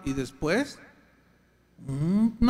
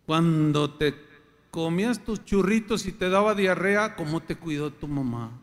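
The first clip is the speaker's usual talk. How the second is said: ordinary talk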